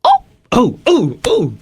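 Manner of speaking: very high squeaky cartoon mouse; Mickey Mouse voice. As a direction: falsetto Mickey Mouse cry. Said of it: "To Mickey Mouse voice?".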